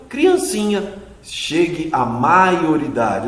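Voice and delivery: normal talking voice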